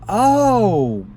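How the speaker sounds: long, loud, high "oh"; surprised recognition. Retold or imitated. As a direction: act out sudden realization. Woman recognizes the man she knows.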